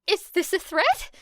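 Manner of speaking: high, anxious stammer; nervous squeak of a question; stammering Kaminari. A narrator's reading. Kaminari asking if Era threatens him, high stammer. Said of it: "stammered Kaminari".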